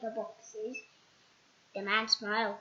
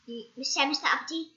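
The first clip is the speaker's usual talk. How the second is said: ordinary delivery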